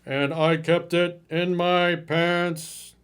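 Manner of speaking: very slow, stiff mock voice; drawn-out mockery of the caller; mocking nice guy. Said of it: "quoting caller mockingly".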